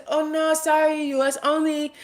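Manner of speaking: high, lightly voiced customer-service brush-off; mock company reply. Mimicking the shop's reply to foreign commenters, high and prim. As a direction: play the polite shop turning buyers away. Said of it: "is what they would say".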